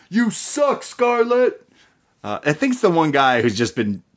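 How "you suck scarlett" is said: high mock heckle from the crowd; fan heckle. Crowd troll heckling Scarlett, high mock yell. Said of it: "one guy just really loud goes".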